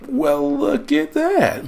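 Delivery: high, drawn-out mock amazement; playful, stretched-out fake wonder; mock surprise. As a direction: act amazed at a discovery, slow and high. Feigned discovery of more questions.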